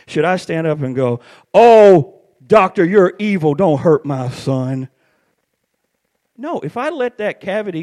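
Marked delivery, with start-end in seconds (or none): 1.5-4.9 loud, hammed-up parental plea; mock outrage at full volume; overdramatic mock protest. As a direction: cry out loudly, then slow down on the plea. Acts overdramatic parent at the dentist.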